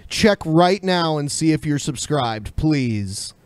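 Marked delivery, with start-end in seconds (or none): none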